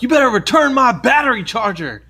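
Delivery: high-pitched mock outrage; imitated angry demand. Men quarreling about a battery, high mock-angry demand.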